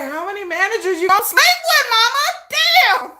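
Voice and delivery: high, loud scolding; exasperated son. Retold or imitated. Son scolding his mother, high and loud. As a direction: scold mom in disbelief. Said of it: "My son be like".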